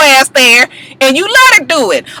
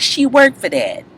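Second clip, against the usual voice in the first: mild [name] retort; 3 semitones lower, 6 dB quieter than usual. Retold or imitated. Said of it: "[name] was like".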